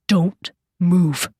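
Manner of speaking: each word set apart and emphasized; slow hiss. A narrator's reading. Yeshua warning Jenny to freeze, slow emphatic hiss. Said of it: "hissed Yeshua slowly, emphasizing each word".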